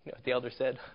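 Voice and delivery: normal talking voice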